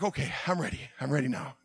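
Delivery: soft, low self-reassurance; quietly gather yourself; calmed down. Retold or imitated. Driver readying himself to go out, calm and soft. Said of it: "once I finally calmed down".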